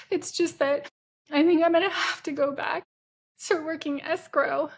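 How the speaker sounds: high, teary pleading voice, half-performed; imitating herself crying on the phone; retold crying. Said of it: "And I'm crying hard".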